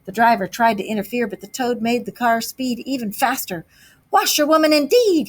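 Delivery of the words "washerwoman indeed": higher, louder indignant shout; reckless shout. A narrator's reading. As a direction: fling it out recklessly. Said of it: "he shouted recklessly".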